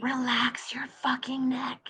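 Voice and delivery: slow, unvoiced-breath whisper into someone's ear. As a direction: whisper it slowly, like a secret cue to one student. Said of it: "whisper in their ear".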